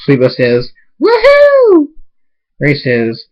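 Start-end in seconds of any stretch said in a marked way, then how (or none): 1.0-1.9 very high, loud squealed whoop; piercing celebratory whoop; whooping cheer. Acted. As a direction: whoop with shrieking delight. Excited cheer during the wedding.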